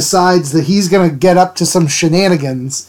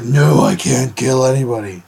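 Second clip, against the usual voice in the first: lower, gruff mock Batman growl; Batman impression; 5 semitones lower, 3 dB quieter than usual. Vlogger voicing Batman refusing to kill Conan, mock gruff. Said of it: "That was my Batman impression".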